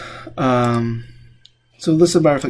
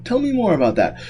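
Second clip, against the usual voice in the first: mock therapist; 9 semitones higher than usual. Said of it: "the joke about, you know, psychiatrists".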